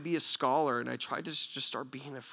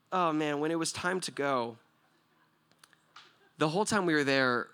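normal talking voice

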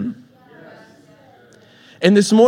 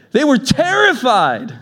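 slow, stretched, higher terrified; dramatic terrified; 7 semitones higher, slower than usual. Acted. Preacher dramatizing the disciples' fear for the church, slow stretched terrified. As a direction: let terrified hang in the air. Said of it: "Someone say terrified".

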